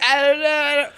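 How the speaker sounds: put-on high, whiny little-kid denial; kid's whine. Guilty kid answering mom, high whiny denial. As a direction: pipe it up like a guilty child.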